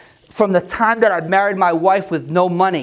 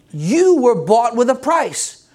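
ordinary talk, nothing marked

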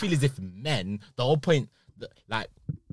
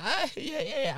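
much higher, airy yeah yeah; high mock agreement; 13 semitones higher, 4 dB quieter than usual. Mimicking the girl's coy reply, high and playful. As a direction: squeak out a knowing agreement. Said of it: "He's like".

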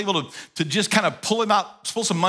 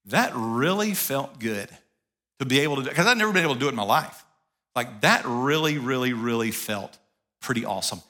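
softer and lower, fading almost to a hush on the last words; quiet, tender recollection; 7 semitones lower, 3 dB quieter than usual. Retold or imitated. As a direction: savour the memory, letting the voice sink softer. Tender memory of giving money.

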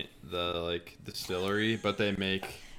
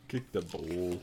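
put-on softer, lower Australian accent; 5 semitones lower, 8 dB quieter, much slower than usual. Echoing a friend's joke, low Australian accent. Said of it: "stuck in your Australian accent".

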